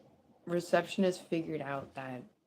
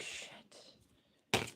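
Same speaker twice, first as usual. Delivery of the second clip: whispered curse; 8 dB quieter than usual. Retold or imitated. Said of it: "this person's like".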